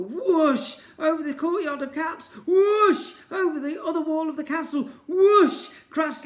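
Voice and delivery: high, loud whooshing action; excited launch. Acted. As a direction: ride the blast up and over. Ned blasted over castle.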